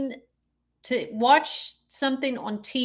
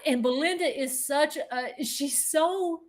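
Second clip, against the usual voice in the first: usual speaking voice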